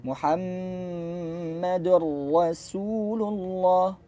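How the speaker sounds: "Muhammadur Rasulullah" drawn out with a thin s; slow recitation. Shown as a demonstration. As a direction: recite slowly and carefully. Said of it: "Contoh, misalkan ayatnya". (bacaan lambat "Rasulullah" dengan sin tipis)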